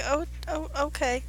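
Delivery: put-on a shy girl giving in, very soft; timid little voice. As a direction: barely dare to say it. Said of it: "She's like".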